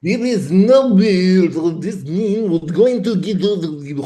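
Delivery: slow, exaggerated French accent; hammed-up French accent, drawn out and high; mock French accent. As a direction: put on a cartoon French accent. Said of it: "working on my French accent".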